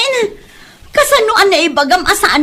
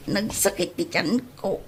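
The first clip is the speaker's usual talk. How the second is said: much softer, aching low voice; pained moan; 10 semitones lower, 14 dB quieter than usual.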